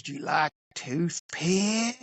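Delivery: slow, odd trucker voice with rising ask; drawn-out creepy stranger asking; a stranger at the pump, slowly and oddly offering. Retold or imitated.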